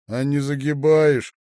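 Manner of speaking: loud, high, teasing question; sly teasing. A narrator's reading. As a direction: needle playfully with feigned doubt. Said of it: "лукаво усмехнулся он". (лукаво, с насмешливым сомнением)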